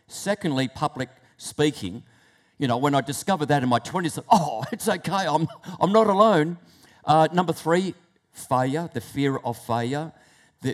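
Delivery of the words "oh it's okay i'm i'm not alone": higher, louder, with a relieved laugh; laughing relief. Retold or imitated. Acts relieved discovery, with a laugh.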